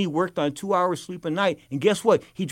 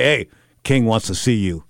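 lower, slow, ominous summons; messenger's warning; 5 semitones lower, 5 dB louder than usual. Retold or imitated. King's man summons him to the boss, low and slow. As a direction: deliver the message like a bad omen. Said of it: "And they say".

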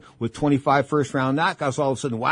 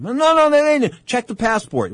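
insisting; 10 semitones higher, 4 dB louder than usual. Retold or imitated. Retold argument with corner man.

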